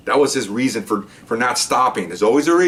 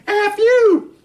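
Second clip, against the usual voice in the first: very high, loud mock shriek; imitated screaming defiance; 5 dB louder than usual. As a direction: screech it back like a furious mother. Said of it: "She's screaming".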